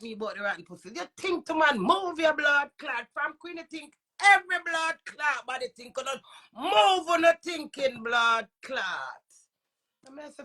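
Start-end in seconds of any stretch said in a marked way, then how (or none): 4.2-9.2 angry shout. Real, not acted. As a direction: yell it down at the critic. Shouting at critic.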